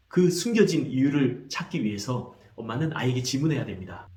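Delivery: ordinary delivery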